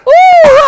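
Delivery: loud, very high "ooh"; imitated rising whoop. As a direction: whoop like something shooting sky-high. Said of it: "It went".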